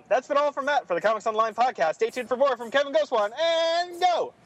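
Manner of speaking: announcer-style sign-off, rising; podcast outro. Acted. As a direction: hype up the show handoff.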